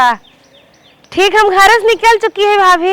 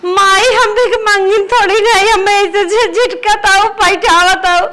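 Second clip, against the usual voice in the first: crying plea to mother; hurt, broken appeal through tears; tearful protest. Acted. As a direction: protest while starting to cry. Scolded daughter pleads in tears.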